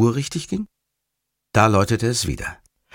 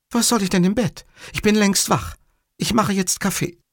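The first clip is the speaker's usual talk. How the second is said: high-pitched, peeved retort; a woman's high voice, refusing; cross Livia; 11 semitones higher than usual. Acted. Livia refuses to go back to bed, high and cross.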